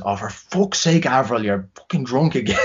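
exasperated dad. Retold or imitated. Bouncer dad scolding his drunk daughter, exasperated. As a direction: sigh it out like a fed-up father. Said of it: "And then he just goes".

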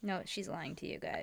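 conspiratorial whisper. Real, not acted. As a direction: whisper it to the audience. Confiding aside to audience.

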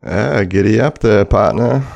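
a hint of a Southern cowboy greeting; playful, light Southern twang; mock southern accent. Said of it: "That's my southern accent".

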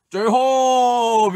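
long, high, loud drawn-out call. Retold or imitated. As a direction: burst in hollering, stretch the vowel way out. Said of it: "이 형이 갑자기". (형 흉내 내며 길게 외치기)

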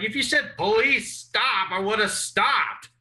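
high, protesting comeback; indignant retort. Retold or imitated. Tased teen arguing back at the cop, indignant.

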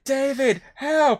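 high, loud mock cry for help; shrill-pitched imitated shout, rising to help; kid shouting for help. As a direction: yell it like a kid in the water calling his hero. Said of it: "he's like".

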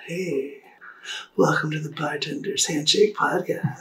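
soft, low podcast host greeting; a calm, understated intro voice; mellow podcast intro. Retold or imitated. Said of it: "the other one was like".

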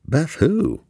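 high, puzzled question; confused Beth who. A narrator's reading. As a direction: ask it baffled, pitch jumping up.

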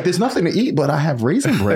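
mother's voice. Retold or imitated. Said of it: "She's like".

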